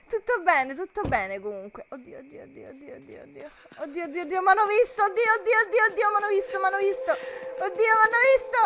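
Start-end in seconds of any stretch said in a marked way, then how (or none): 1.9-3.5 frightened near-whisper, much softer; scared hushed. Real, not acted. Nascosta, il nemico la cerca. 3.8-8.6 spotted and freaking out; panicked. Real, not acted. Scoperta dai nemici nel gioco.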